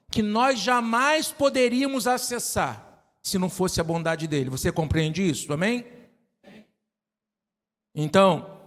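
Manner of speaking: normal talking voice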